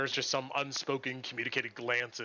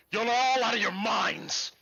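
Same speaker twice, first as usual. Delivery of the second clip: loud, raised contempt; scornful paladin; 8 semitones higher, 6 dB louder than usual. Acted. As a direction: sneer at the cultists. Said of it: "Sigurius goes".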